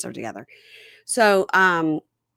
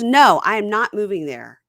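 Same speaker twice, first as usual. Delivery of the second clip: louder, slow, firm no; firm mock refusal; 5 semitones higher, 7 dB louder than usual. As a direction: plant your feet and refuse, slowly. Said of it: "She goes".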